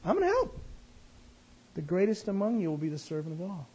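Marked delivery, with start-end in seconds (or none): 0.0-0.6 cheerful helper. Retold or imitated. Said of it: "I like".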